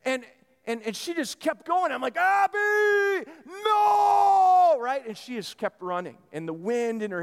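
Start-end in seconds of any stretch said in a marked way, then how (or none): 2.2-4.8 loud, high, drawn-out yell; panicked shout. Retold or imitated. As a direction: holler after a runaway child. Dad yells after running daughter.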